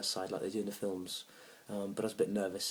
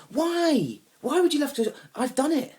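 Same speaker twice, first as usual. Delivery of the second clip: loud, very high-pitched indignation; exasperated outburst; 11 dB louder, slower than usual. Acted. Man outraged at swimming with dolphins, exasperated. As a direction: shriek it in comic outrage.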